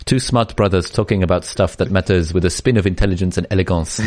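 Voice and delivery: reading aloud in a mock French accent; put-on French accent, gently comic; fake French accent. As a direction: take the piss out of a French accent. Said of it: "an impressive French accent".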